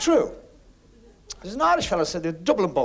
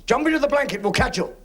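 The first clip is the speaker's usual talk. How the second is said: calling up; 8 semitones higher, 5 dB louder than usual. Acted. Firemen call up to man.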